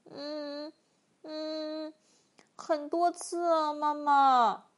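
whiny hums, then drawn-out admission; hammed-up storybook snivel to mother; whimpering. A narrator's reading. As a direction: blubber like a scolded child. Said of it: "巨人呜咽着说".

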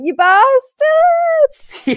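hammed-up shriek of betrayal; mock furious yell. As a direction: yell at the runaway father. Dog mother yelling at dad.

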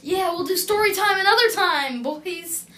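loud and high, playful. Real, not acted. Loud playful sign-off to the boys.

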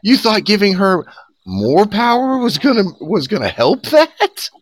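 high, sarcastic disbelief; incredulous mockery. Real, not acted. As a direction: scoff at their naive logic.

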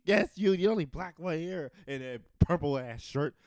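single someone out in hammed-up annoyance; mock angry confrontation. Comedian acts out neighbor confronting the driver, mock angry.

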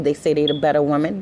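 normal talking voice

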